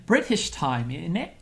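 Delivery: put-on a second clumsy British accent take on "British time, in it"; hammed-up fake British; bad British accent. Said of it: "that was a terrible British accent".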